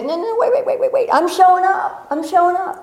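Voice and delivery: high-pitched, hammed-up insistence; insistent protest. Answering people who say no, high insistent protest. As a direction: pitch it way up and refuse to be stopped. Acting out refusal to be stopped.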